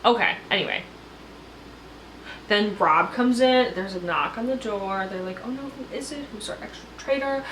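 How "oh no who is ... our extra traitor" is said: hushed worry. Retold or imitated. Traitors wonder at the knock.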